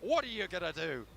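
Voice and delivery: high, loud excited commentary; live play-by-play. Acted. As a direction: build the hype call by call.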